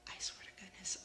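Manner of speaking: breathy confiding whisper. Real, not acted. Vlogger confiding to viewers in a whisper. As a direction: whisper it like a confession.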